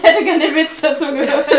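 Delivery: loud laugh through the words. Real, not acted. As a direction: crack up while speaking. Bursts out laughing at joke.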